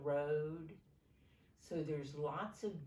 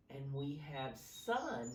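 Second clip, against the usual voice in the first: ordinary delivery, nothing marked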